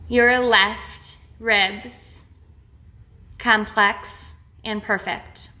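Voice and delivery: slow, measured meditation voice with long pauses; name each body part slowly, leaving space after it; guided body-scan meditation. Real, not acted. Guide leading listeners through a body awareness meditation, slow and measured.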